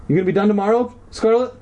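high-pitched question to a cat; pet-directed talk. Real, not acted. Talking to the cat.

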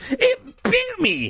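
high, drawn-out pained cry; shriek. A narrator's reading. Mike just bitten by a snake, shrieking high. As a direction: squeal it slowly, without much volume. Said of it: "he shrieked".

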